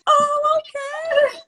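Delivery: very high tearful squeal; mock crying. Client accepting her therapist's reassurance, mock crying. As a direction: act out a blubbering acceptance, slowing at the end. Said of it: "I was, like, crying".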